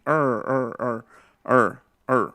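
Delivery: curled-back American-style "er"; retroflex R. Shown as a demonstration. Teacher shows students the backward R, retroflex. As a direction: roll the tongue tip back on each "er". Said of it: "se va para atrás, así".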